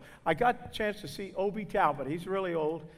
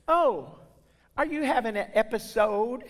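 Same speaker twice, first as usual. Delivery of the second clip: higher-pitched New York woman, concerned; New York lady; 5 semitones higher, 4 dB louder than usual. Retold or imitated. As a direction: imitate a nosy little lady from New York. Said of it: "I think from New York, from the sound of her voice".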